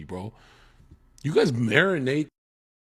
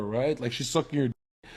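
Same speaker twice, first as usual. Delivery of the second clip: ordinary talk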